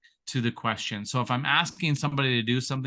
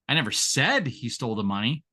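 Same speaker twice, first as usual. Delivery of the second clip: said stressed, question lilt. Shown as a demonstration. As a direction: lean on the word said. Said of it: "Say the same exact thing six different ways".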